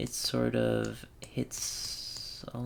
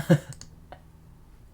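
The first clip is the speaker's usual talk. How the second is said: short breathy laugh; real laugh, mostly breath; 3 dB louder than usual. Real, not acted. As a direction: laugh it off after a groaned "uh". Amused at the next puzzle.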